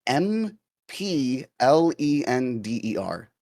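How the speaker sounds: slow, letter by letter spelling; spelled out letter by letter. Shown as a demonstration. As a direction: spell each letter slowly. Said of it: "if I were to spell it with the equivalent Latin letters".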